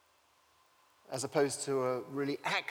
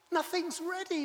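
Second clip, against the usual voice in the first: fretting Martha; 12 semitones higher than usual. Acted. Martha fretting as Jesus teaches.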